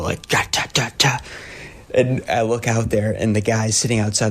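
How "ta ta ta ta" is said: imitated knocking. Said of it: "I hear pounding on the window".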